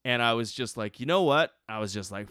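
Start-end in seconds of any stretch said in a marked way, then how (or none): 1.0-1.5 fed up. Retold or imitated. Retold snapping at his friend.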